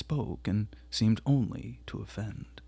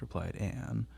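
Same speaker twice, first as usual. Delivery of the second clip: usual speaking voice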